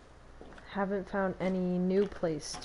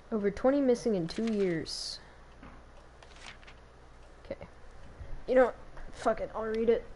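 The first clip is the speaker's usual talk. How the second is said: ordinary talk, nothing marked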